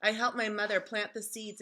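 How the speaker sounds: ordinary delivery, nothing marked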